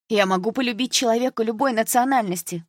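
high-pitched protest; aggrieved, lifted voice; indignant insistence. A narrator's reading. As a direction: argue the point passionately. Upset daughter keeps protesting.

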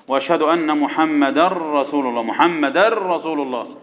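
"محمدا رسول" run together as muhammadar-rasul, long doubled r; merged n. Shown as a demonstration. Teacher fixing a pupil's adhan, "محمدا رسول" merged into a held r. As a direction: drop the n and hold the r in "محمدا رسول". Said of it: "يبقى في إدغام".

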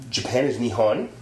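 ordinary delivery

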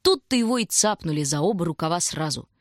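usual speaking voice

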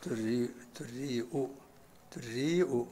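دريء as durri'u, glottal stop then u; u and hamza. Shown as a demonstration. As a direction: round the first vowel of دريء and add 'u at the end. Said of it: "بضم الدال والهمزة". (دريء بضم الدال والهمزة)

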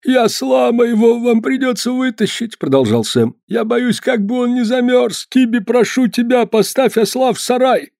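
high, strained voice of a hurt man; injured peddler. Acted. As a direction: voice a frozen, injured peddler in pain.

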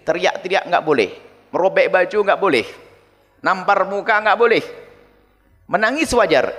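usual speaking voice

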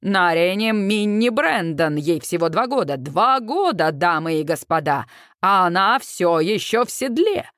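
low-pitched showman's commentary; deep emcee voice; event announcer. A narrator's reading. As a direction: play the rodeo host on the mic. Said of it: "комментирует в микрофон ведущий".

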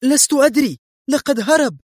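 very high, loud protest; angry shout. A narrator's reading. Woman snapping at the man pulling her hair, angry. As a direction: cry out in angry exasperation. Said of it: "صاحت في غضب".

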